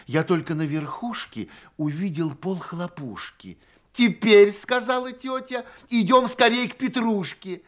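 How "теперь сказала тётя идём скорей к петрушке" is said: high, loud host's announcement; loud, high-pitched rallying call. A narrator's reading. Aunt calls children to next show.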